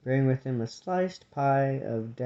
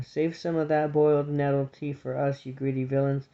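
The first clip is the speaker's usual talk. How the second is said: usual speaking voice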